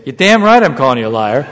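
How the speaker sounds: furious retort at full volume; angry shout. Retold or imitated. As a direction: stand up and shout it. Standing up, shouting back.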